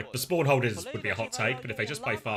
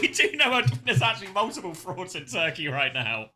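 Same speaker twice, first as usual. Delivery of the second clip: laughing while talking; 7 semitones higher than usual. Real, not acted. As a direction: laugh through the words. Laughing about frauds.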